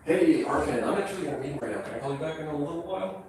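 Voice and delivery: hushed phone brush-off; dodging a caller. Real, not acted. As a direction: quietly put off a caller. Takes landlord's call mid-video.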